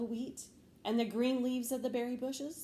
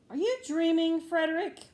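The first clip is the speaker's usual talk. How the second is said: higher, louder scolding question; reproachful; 7 semitones higher, 9 dB louder than usual. Acted. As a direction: chide him for dozing off. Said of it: "they asked reproachfully".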